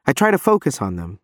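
plain reading voice

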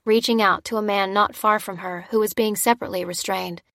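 ordinary delivery, nothing marked

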